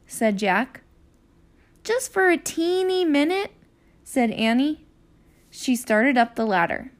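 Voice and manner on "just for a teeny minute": put-on high, coaxing little-girl voice; pleading. Annie begs Jack to let her climb, high and coaxing. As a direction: wheedle permission sweetly.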